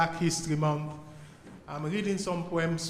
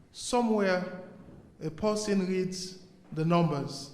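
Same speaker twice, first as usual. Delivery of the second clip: emphatic, measured poetry reading; poem recital. Acted. Poet reading to an audience about a world at war, emphatic recital. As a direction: declaim each line, then leave a pause.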